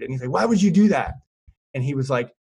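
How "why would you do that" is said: retold frustrated yell, raised high and loud; a half-acted angry shout; frustrated outburst. Half-drowned man yelling at his mentor, frustrated shout. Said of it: "he's super frustrated".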